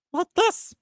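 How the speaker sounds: very high, squeaky-pitched character voice; high put-on voice. Shown as a demonstration. Host showing off great voices, high-pitched. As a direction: jump right up high for a silly voice. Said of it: "I do great voices".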